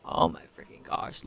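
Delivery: hushed, voiceless gasp of dismay; overcome, almost no voice left; soft teary whisper; on the verge of crying. Real, not acted. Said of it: "I'm gonna cry".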